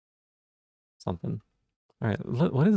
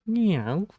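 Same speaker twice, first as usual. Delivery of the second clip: playful meow; 8 semitones higher than usual. Pretend. Meows at the cat.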